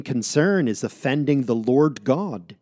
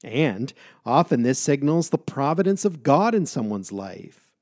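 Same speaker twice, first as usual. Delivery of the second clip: ordinary delivery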